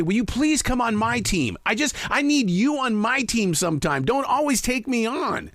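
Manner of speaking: high, slow pleading to his wife; mock pleading. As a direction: beg her, drawn out, to be on his side. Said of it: "I say".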